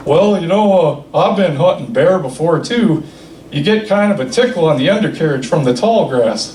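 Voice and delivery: a hint of Hank Hill, deadpan; Hank Hill impression. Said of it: "sounds exactly like Hank Hill".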